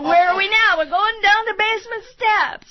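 high, loud teasing call; taunting big brother; mock brother taunt. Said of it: "And I said".

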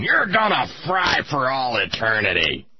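mock divine condemnation; hammed-up voice of judgment; wrathful God. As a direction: pronounce doom like an angry deity. Voices God damning a child.

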